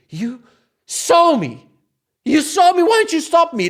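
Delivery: high, loud outraged shout; indignant protest. Retold or imitated. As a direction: hurl it as a disbelieving accusation. Acted protest at the check-in guy.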